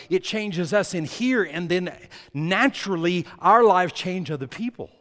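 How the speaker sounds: loud, fervent preacher's roar; preaching. Acted. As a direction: preach it out to the back row. Preaching at full force.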